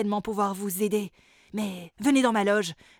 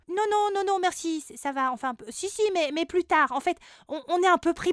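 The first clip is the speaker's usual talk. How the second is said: put-on girl's voice; 7 semitones higher than usual. Girl refusing a song.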